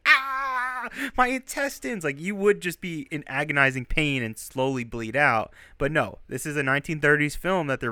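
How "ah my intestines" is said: high, hammed-up cry of a gut-shot man; mock dying scream. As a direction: ham up a melodramatic movie death. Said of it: "Not like you're just screaming".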